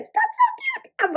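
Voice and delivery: very high, slow squeal of delight. Real, not acted. Squealing to viewers over a cute nail. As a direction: stretch it into a squeal.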